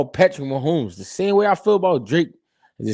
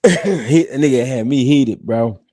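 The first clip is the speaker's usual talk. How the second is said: ordinary delivery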